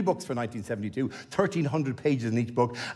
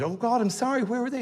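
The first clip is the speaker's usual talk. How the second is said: higher-pitched, mildly flustered apology; startled apology; 8 semitones higher than usual. Retold or imitated. Desk woman apologising over missing names, lightly acted surprise. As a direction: imitate a taken-aback clerk, pitched up.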